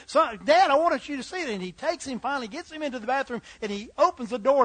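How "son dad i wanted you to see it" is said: insistent son. Acted. Son insists to busy father.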